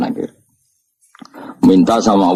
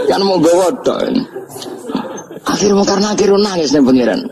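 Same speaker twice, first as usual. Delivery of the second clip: words squeezed out through high laughter; can barely speak for laughing; real laughing talk, pitched high; laughing while talking; 12 semitones higher than usual. Real, not acted.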